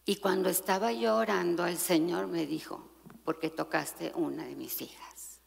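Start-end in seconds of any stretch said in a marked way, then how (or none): 3.2-5.4 hushed voice of the Lord. Retold or imitated. The Lord speaking in prayer.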